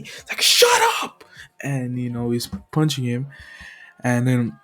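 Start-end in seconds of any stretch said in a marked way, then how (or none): 0.4-1.2 high, explosive shout; angry yell. Acted. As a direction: cut someone off with a furious high yell. Attacker silences the hero.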